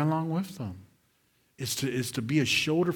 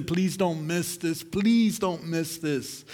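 normal talking voice

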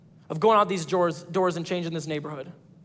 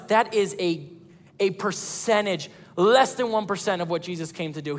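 usual speaking voice

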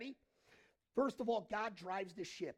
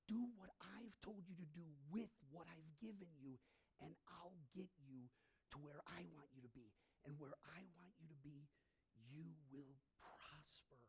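much softer, unhurried reassurance; quiet reassurance; 4 semitones lower, 11 dB quieter than usual. Acted. God answers a worried believer who wants control, quiet reassurance. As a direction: speak it softly, as God calming a worrier. God's reassuring voice, quoted in sermon.

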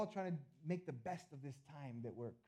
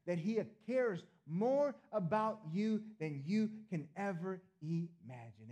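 louder, slower, higher swell; preaching climax; 4 semitones higher, 8 dB louder, slower than usual. Real, not acted. Pastor telling the church God cares, slow loud swell. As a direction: stretch it out and let it swell.

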